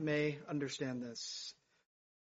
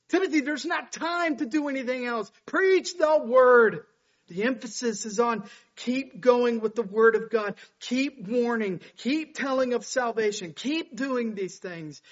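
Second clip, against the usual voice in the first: loud, high-pitched exhortation; forceful, insistent pulpit urging; urgent preaching; 7 semitones higher, 9 dB louder than usual. Acted. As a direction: drive home each command to the congregation. Keeps exhorting at full force.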